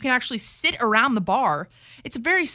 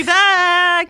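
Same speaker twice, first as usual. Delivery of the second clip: hammed-up Southern party whoop, higher and louder; mock party cheer; 8 semitones higher, 8 dB louder than usual. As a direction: shout it like partygoers back from Lent. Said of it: "we're like".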